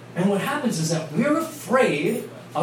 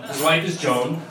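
ordinary talk

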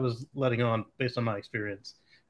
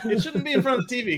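laughing; 9 semitones higher, 4 dB louder, faster than usual. Real, not acted. Still laughing.

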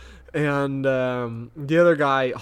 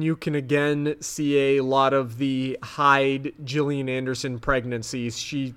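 ordinary delivery

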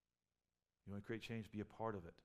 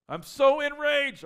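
very high, loud, slow mocking shriek; hammed-up enraged cry; 21 dB louder, much slower than usual. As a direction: overplay the fury for a laugh.

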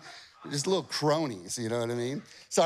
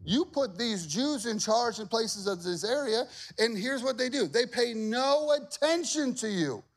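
high, slow whiny accusation; tattling officials; 7 semitones higher than usual. Acted. Officials complaining to the king, whiny. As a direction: whine it to the king like a snitch. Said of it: "these officials are like".

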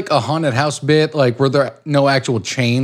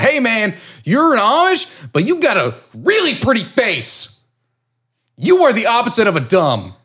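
nice gang shout; 8 semitones higher than usual. Retold or imitated. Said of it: "and it was like".

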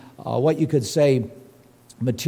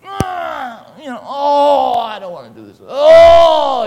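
high, whiny mock complaint with a shout; mock whining grumbler; 11 semitones higher, 14 dB louder, slower than usual. Preacher mimics a grudging believer, whining loudly. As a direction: whine it like a reluctant giver. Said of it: "It's about how did you do it?".

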